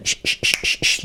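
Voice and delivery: five short unvoiced "sh" bursts; SH demo. Shown as a demonstration. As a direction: hush out a rhythmic "sh" drill. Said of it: "Letra clave, S H".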